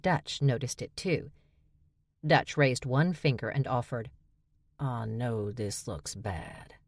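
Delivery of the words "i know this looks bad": low, soft and slow, sheepish; hushed, slow understatement; sheepish admission. A narrator's reading. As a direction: own up quietly like a caught kid. Dutch admits trouble sheepishly.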